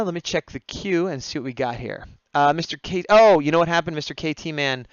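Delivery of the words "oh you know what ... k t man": normal talking voice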